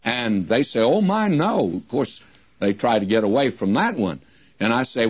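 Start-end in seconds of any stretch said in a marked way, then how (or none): 0.8-1.7 high-pitched, imitated polite denial; mock-shocked little protest, pitched up. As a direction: mimic listeners hurrying to deny it. Listeners politely protest, acted out.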